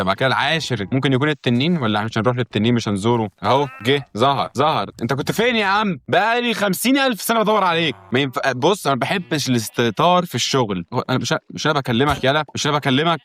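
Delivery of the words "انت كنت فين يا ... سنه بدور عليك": mock scolding. Scolding villager who appeared.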